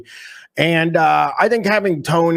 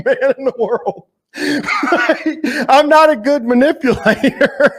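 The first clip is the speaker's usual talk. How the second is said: high-pitched, helpless laughing speech; words squeezed through real laughter; 11 semitones higher than usual. Real, not acted. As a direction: crack up while getting the words out. Cracks up at own joke reply.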